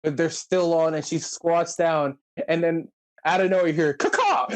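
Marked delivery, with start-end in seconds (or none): 3.9-4.5 bird caw. Retold or imitated. Imitating a bird call.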